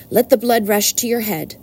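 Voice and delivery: usual speaking voice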